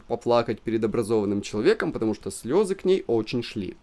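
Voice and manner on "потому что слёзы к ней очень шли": usual speaking voice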